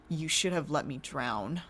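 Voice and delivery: low, bitter, quiet anger. Acted. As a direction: voice drops into cold anger. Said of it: "My voice lowered into anger".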